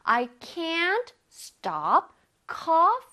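very slow drill, rising onto coughing; slow repeat-after-me. Shown as a demonstration. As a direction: draw it out for students to echo. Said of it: "해볼게요".

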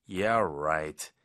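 low, drawn-out doubt; understated skeptical "yeah, right"; mild sarcasm. Shown as a demonstration. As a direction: brush it off with restrained, low-pitched disbelief. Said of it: "좀 더 강하게".

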